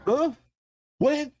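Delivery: sudden high startled what; high-pitched disbelieving huh; surprised. Retold or imitated. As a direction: blurt it out, caught off guard. Stunned reaction to friend's claim.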